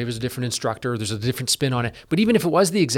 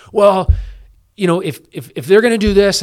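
mock indignant outburst, louder and higher; retold angry outburst; 6 semitones higher, 8 dB louder than usual. A colleague retelling his own mad rant, loud mock indignation. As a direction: replay the mad reaction to the pay package, raised and heated. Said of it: "And I was mad".

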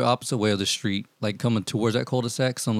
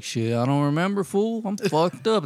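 higher-pitched mock stoner voice, a laugh slipping in; stoned guy; 8 semitones higher than usual. Driver imitating the stoned customer, higher laughing stoner voice. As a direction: play the too-high customer shrugging it off. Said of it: "He was like".

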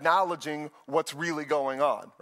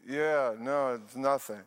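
sleepy husband; 4 semitones lower, slower than usual. Retold or imitated. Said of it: "And I".

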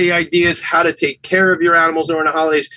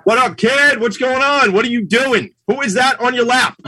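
excited greeting; 8 semitones higher than usual. Real, not acted. Greets partner, high playful.

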